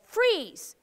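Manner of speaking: freeze, called high and firm. Shown as a demonstration. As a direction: call out the command clearly. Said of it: "what we're gonna say is".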